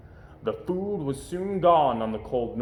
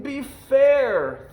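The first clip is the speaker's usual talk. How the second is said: begging; 11 semitones higher, 7 dB louder than usual. A narrator's reading. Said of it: "Fidwick begged with a lump".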